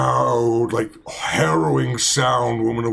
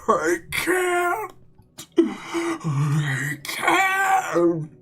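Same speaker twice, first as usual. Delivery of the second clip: high, weeping plea in a much higher voice; mournful crying; much slower than usual. Shown as a demonstration. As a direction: sob it out like a grieving woman. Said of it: "and more like".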